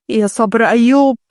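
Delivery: usual speaking voice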